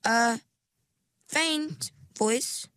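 "faint" lifted high and set apart; hesitate, then single out "faint" on a high pitch; careful, high-pitched emphasis on "faint"; stress on faint. A narrator's reading. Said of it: "She emphasized the word faint".

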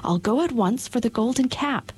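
put-on high eager girl, dropping to a hush at the end; joyful Dorothy. A narrator's reading. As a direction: rush off to fetch the cap, eager. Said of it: "said Dorothy joyfully".

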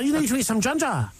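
"need" pitched very high in a hammed-up Kiwi accent. Quoting the Kiwi lass on the boat in an over-the-top Kiwi accent. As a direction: Kiwi lass pushing ginger on him, all in a mock high Kiwi accent. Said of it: "It's your best Kiwi accent".

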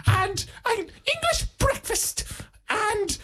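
very high-pitched put-on voice; prim tearoom customer. Customer orders a scone with jam, in a very high put-on voice. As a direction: play a squeaky, polite customer ordering.

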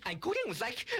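plain reading voice

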